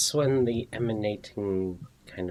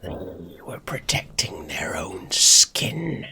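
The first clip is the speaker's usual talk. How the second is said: loud, slow rasping whisper; gravelly voice; 7 dB louder than usual. Acted. Sword spirit scoffs at wielder's trust, gravelly whisper. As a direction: hiss it forcefully as a sinister spirit. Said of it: "the gravelly voice calls out".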